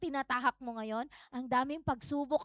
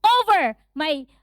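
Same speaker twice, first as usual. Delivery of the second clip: loud, high, commanding rebuke; forceful preacher's warfare prayer; strong conviction; 6 semitones higher, 10 dB louder than usual. Shown as a demonstration. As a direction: order the enemy away with full authority. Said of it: "with strong conviction".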